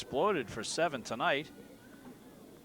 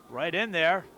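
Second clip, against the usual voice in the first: excited; slower than usual. Real, not acted. Goal chance in match commentary.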